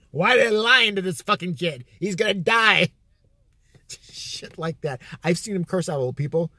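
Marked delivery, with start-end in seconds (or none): 0.0-2.9 raised, punchy mock shouting; mock heckling rant. Uncle ranting at a cancer billboard, mock heckling. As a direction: rant callously as the imitated uncle.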